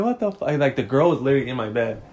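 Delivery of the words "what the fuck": high-pitched incredulous reaction; retold shock. Answering grandma's intrusion in the story, high-pitched shock. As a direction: replay the disbelief up high.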